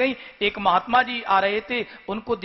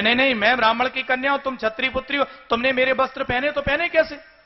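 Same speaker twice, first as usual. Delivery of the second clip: Devayani presses the offended princess, voice raised and hurried; indignant protest; 5 semitones higher, faster than usual. Acted. Devayani scolding Sharmishtha in a raised, rushed, indignant voice. Devayani repeats her quarrel louder.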